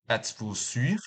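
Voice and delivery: "sûr" said with a clear upward lift; rising question tune. Shown as a demonstration. Teacher demonstrating a French yes-no question with a rise. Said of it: "the intonation will rise at the last word".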